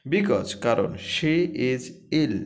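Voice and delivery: words said slowly and clearly; slow classroom dictation; slow reading. Shown as a demonstration. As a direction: read it slowly so students follow. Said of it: "teacher reads sentence slowly".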